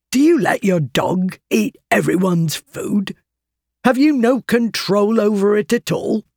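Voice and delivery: cold, scolding stranger rising in anger; angry stranger. A narrator's reading. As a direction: bark it like an irritated stranger. Angry man voiced by narrator.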